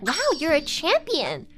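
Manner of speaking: high, swooping mock admiration; imitated doctor's praise. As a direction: mimic someone gushing at a patient. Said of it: "she's just like".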